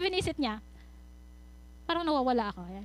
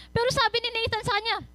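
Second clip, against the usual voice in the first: usual speaking voice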